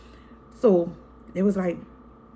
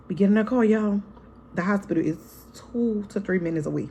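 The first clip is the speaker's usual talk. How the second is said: usual speaking voice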